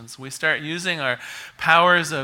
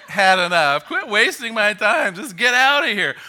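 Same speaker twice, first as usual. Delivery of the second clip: louder, higher acted exasperation; fed-up bartender; 5 semitones higher, 6 dB louder than usual. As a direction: throw him out, playing an annoyed barman.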